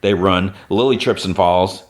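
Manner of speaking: normal talking voice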